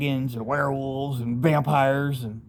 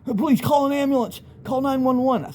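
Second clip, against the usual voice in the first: higher, frantic plea for help; retold panicked plea; 9 semitones higher than usual. Friend begging him to call for help, panicked plea. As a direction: imitate the friend begging for an ambulance. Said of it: "He's like".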